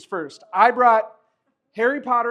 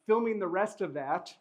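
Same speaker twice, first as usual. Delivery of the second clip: ordinary talk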